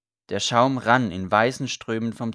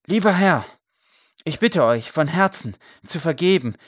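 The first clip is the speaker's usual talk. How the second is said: raised, earnest confession; earnest plea; 5 semitones higher, 6 dB louder than usual. A narrator's reading. Priest begs the knight to forgive him, earnest raised plea. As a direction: plead from the heart, settling toward the end.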